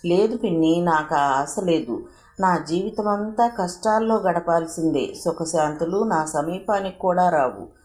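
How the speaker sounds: usual speaking voice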